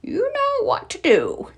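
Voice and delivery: high, sly scheming line; villain mayor giving a sneaky order; scheming hint. Acted. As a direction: plot in secret. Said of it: "whispered to a Boomer".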